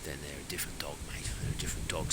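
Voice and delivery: hushed conspiratorial whisper. Shown as a demonstration. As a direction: lean in and whisper it like a secret. Whispered warning about hunting dogs.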